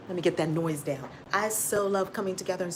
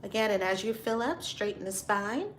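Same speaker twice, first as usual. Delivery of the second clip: guided breathing; 4 dB quieter than usual. Acted. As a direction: lead them calmly through each breath. Leads a breathing exercise.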